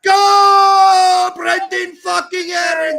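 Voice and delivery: huge high roaring shout of joy; goal celebration shout. Real, not acted. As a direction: scream it as he scores. Watching teammate score, celebrating.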